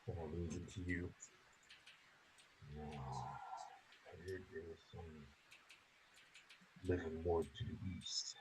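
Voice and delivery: very soft, low undertone; hushed reply. Acted. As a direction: lean in and answer under your breath. Companion answers the whispered question.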